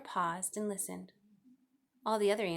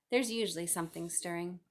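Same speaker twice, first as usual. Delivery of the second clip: plain reading voice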